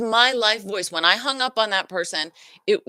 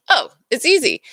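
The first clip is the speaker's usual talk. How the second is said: much higher and louder, bursting out; excited realisation; 7 semitones higher, 11 dB louder than usual. Real, not acted. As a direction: suddenly get it and blurt it out. Sudden realisation mid-explanation.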